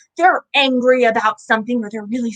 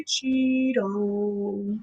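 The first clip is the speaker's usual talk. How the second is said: ordinary delivery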